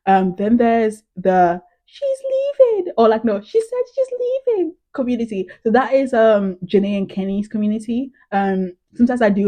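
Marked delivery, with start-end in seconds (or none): 1.8-2.9 squeaky, very high, slow; very high slow mocking squeak; high-pitched quote. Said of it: "in a really high pitched voice". 3.4-4.7 very high squeaky imitation; teary Kenny quoted in a very high voice; high-pitched quote. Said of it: "in a really high pitched voice".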